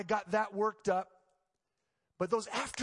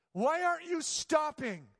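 anguished plea; 9 semitones higher, 3 dB louder, slower than usual. Retold or imitated. Reenacted prayer of protest to God.

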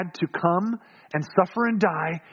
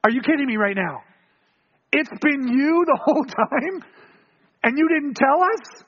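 acted disbelief, climbing higher and slowing; astonished; 5 semitones higher than usual. Disciples suddenly recognize Jesus at dinner, incredulous.

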